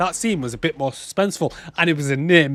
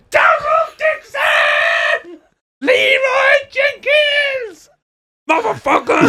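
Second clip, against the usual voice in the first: much higher, slow drawn-out yell; loud shout; 4 dB louder, much slower than usual. Retold or imitated. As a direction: bellow the names out, stretched long. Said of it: "You need to say it louder".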